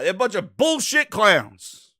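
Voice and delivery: ordinary talk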